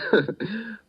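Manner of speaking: a short high mocking laugh. Villain laughs off Henderson's warning, high and mocking. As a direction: laugh off the threat, high and light.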